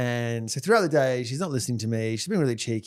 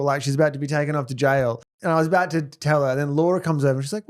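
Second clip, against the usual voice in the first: ordinary delivery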